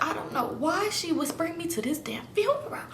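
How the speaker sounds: acted whispered grumble; retold whisper. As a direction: whisper it as if inside a funeral. Muttered complaint in funeral pew.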